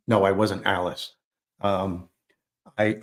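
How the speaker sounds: ordinary delivery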